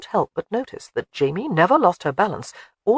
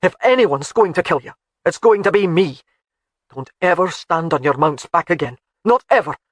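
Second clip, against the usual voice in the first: louder, stern husband's warning; angry husband; 5 dB louder than usual. A narrator's reading. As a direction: lay down the law, seething. Alec furious at his wife.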